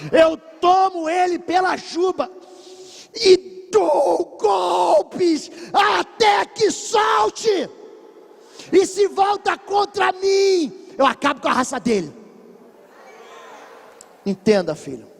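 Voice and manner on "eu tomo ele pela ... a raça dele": crying out at the top of the voice; shouted preaching. Real, not acted. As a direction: preach it at full force like grabbing the lion. Preaching climax, fighting the lion.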